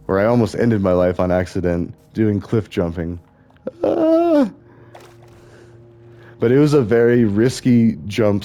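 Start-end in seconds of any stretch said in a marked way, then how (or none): 3.8-4.5 sudden high yelp, much higher; brief high-pitched yelp of alarm; startled yelp. Retold or imitated. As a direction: let out a quick shocked cry as if falling. Recalls near-fatal cliff jump.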